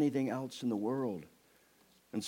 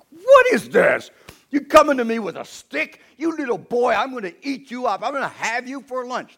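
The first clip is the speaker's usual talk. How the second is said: booming giant; 12 dB louder than usual. Acted. Giant taunting little David, loud mocking roar. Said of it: "The giant comes out, and he's".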